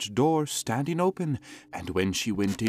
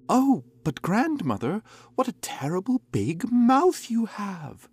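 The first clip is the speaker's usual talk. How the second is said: put-on high, breathless scared child; frightened little girl; 12 semitones higher than usual. A narrator's reading. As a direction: whisper it in fear.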